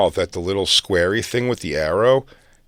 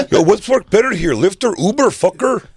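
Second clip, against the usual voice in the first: fake Spanish accent; 11 semitones higher, 5 dB louder than usual. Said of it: "I had to fake a Spanish accent".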